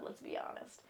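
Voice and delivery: conspiratorial whispered admission; whispered aside. Real, not acted. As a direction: drop to a breathy whisper for the aside. Confiding aside to viewers.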